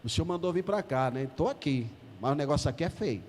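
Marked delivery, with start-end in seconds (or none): none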